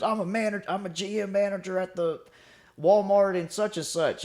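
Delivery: put-on high-pitched older guy voice, insistent; imitated old veteran. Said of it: "one of those older guys".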